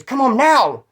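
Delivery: high, loud mock motherly order; mad mom. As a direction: imitate a furious mother demanding it, hitting "now". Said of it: "She's mad".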